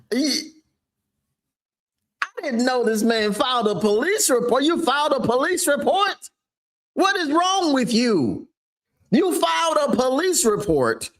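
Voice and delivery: can't believe what he's reading; shocked disbelief. Real, not acted. Shocked outburst at the news.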